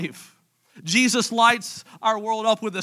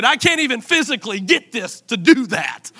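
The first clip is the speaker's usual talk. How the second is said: ordinary talk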